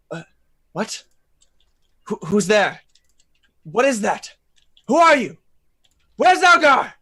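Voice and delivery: growing alarm, calling out; frightened. Acted. Frightened character calls into dark.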